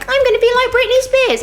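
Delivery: put-on high loud girlish gush; excited kid voice. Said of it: "which gradually over time was like".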